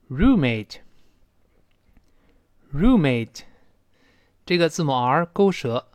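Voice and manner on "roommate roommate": roommate very slow and drawn out, syllable by syllable; read-after-me model. Shown as a demonstration. Teacher asks learners to repeat after her, roommate very slow and clear. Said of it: "跟我读一遍".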